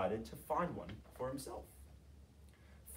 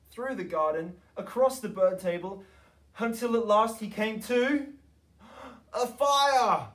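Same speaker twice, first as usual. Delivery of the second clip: rising higher and louder to a big reveal; building excitement; 8 semitones higher, 9 dB louder than usual. A narrator's reading. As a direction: build and build to the fire. Building to the discovery.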